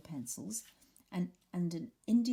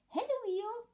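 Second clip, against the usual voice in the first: very high, tiny eager voice; a small excited joey piping up; Roo's squeak; 4 dB louder than usual. Acted. Roo greeting Eeyore at the table, high squeak. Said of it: "said Roo".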